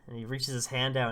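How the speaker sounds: ordinary delivery, nothing marked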